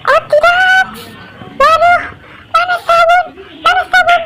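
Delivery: high-pitched, hammed-up distress; squealing mock cries for help; grossed-out panic. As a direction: wail it helplessly, playing up the disgust. Finds unflushed toilet, cries for help.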